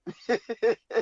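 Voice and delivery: short high chuckle. Real, not acted. Teller amused at surviving the taxi choice, chuckle. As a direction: let out a brief, real laugh. Chuckles after own remark.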